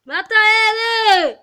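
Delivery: long drawn-out yell; shout. Real, not acted. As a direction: yell it out, stretched slow. Gamers yelling during fight.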